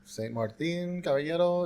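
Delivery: ordinary talk, nothing marked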